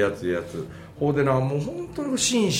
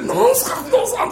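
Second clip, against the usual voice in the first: 1 amused surprise; 4 dB louder, faster than usual. Retold or imitated. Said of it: "とかって言って".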